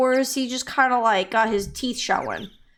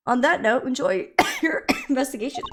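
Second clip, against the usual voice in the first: real coughing between the words; 3 semitones higher than usual. Real, not acted. Streamer reading game dialogue, broken by real coughs. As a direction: push the line out through coughs.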